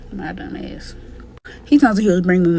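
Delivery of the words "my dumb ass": breathy whisper to herself; whispered self-scolding; whispered aside. Real, not acted. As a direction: drop to a whisper, scolding yourself.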